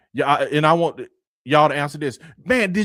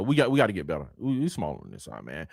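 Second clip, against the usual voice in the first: normal talking voice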